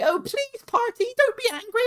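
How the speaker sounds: very high squeaky begging impression; mock pleading co-hosts. Host imitating co-hosts who fear his temper, high mock pleading. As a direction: mimic them pleading in a tiny falsetto. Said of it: "they're like".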